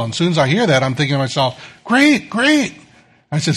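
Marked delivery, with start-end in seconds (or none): 1.8-2.7 sarcastic thought. Retold or imitated.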